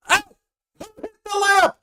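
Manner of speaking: very high, loud squeaky voice calling from inside the lamp; trapped genie yelling. Acted. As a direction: pound on the walls, shout to be let out. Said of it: "and he goes".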